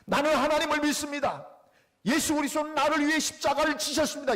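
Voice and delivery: loud, high proclamation; shouted declaration of faith. Retold or imitated. Friend shouting his creed alone in the church, loudly. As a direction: call it out loud in an empty church. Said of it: "냅다 소리를 질렀어요".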